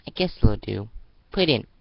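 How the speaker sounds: loud, rising grudging order; grudging approval. Acted. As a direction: approve it reluctantly. Bossy girl approves.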